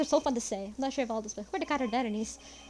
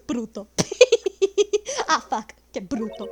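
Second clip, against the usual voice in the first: louder giggling at the name; laughing; 9 dB louder than usual. Real, not acted. Player still laughing at the name Bruto. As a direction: keep laughing, can't stop. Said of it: "I shouldn't laugh".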